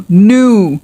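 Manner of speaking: "New" said as "noo"; no y-glide in "New"; a stylish, glide-free "New", rhyming with "too"; stylish pronunciation. Shown as a demonstration. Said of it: "stylish वे में बोलना".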